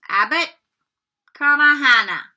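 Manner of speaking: lightly voiced professor calling a name. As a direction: call it out across the hall. McGonagall calls names aloud.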